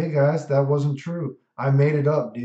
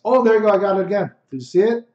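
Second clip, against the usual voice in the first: loud, higher hype; excited; 4 semitones higher, 6 dB louder, faster than usual. Real, not acted. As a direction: celebrate the combo at full volume. Hype after landing combo.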